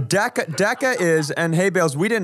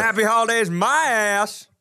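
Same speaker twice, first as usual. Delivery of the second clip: put-on Southern accent; 5 semitones higher than usual. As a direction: sneer it like a Southern good old boy. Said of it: "here comes the Southern accent".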